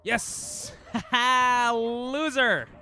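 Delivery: loud, high whooping cheer; triumphant shout. Real, not acted. Streamer gloating after beating the level, yelling in triumph. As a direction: crow over the win at full volume. Beats his best run.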